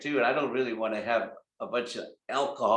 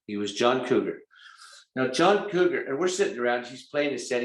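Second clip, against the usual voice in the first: usual speaking voice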